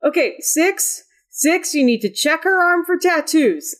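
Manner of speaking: high, urgent instruction at normal volume; worked-up character giving an order; anxious character calling down. Acted. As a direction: call down to an underling, pitched well up.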